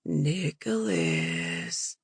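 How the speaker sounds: low, slow, drawn-out sing-song; sing-song greeting. A narrator's reading. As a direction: stretch the name out low and slow. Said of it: "greeted Nick in a sing-song voice".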